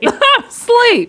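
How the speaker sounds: high laughing echo. Pretend. Echoing her friend's joke, high and laughing. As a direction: squeal it back slowly through a laugh.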